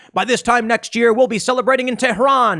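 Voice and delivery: lightly voiced triumphant hawk; war hawk Bolton. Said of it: "who said".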